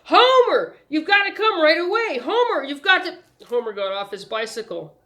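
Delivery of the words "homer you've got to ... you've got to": high, loud, urgent yell; a newsboy yelling for his friend, loud and high; shouting with all his might. A narrator's reading. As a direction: call out across the street, frantic. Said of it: "shouting at him with all his might".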